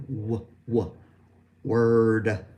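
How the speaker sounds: w sound then word with held R; w to word demo. Shown as a demonstration. Teacher building word from w sound. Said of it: "Let's try the W".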